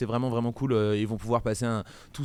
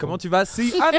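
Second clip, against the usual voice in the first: excited greeting; 9 semitones higher, 6 dB louder than usual. Real, not acted. Accueil enjoué très haut.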